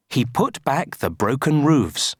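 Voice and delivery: usual speaking voice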